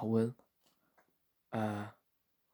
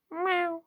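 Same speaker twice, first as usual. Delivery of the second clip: very high, squeaky cat meow; 4 dB louder than usual. Retold or imitated. The cat walks in and greets everyone, very high meow. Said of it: "the cat comes in and he goes".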